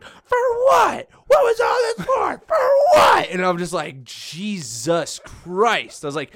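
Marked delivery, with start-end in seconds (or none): 0.0-3.3 very high, loud hysterical shriek; mock screaming. Girl the men fought over, hysterical mock screaming. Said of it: "like, screaming". 4.0-6.0 drawn out very slowly, raised pitch; appalled disbelief. Retold or imitated. Server reacting to the screaming girl, slow appalled disbelief.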